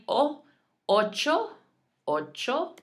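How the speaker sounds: O and ocho with short, pure O, no glide; crisp ocho with unglided vowels; light Spanish O. Shown as a demonstration. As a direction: keep ocho light, OH-cho. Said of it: "nice and light".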